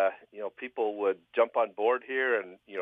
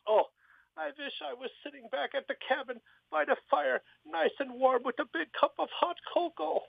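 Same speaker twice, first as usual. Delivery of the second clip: very high falsetto woman's voice; Lena voice. Acted. Lena making her wish to the genie, very high falsetto. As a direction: squeak it out like Lena.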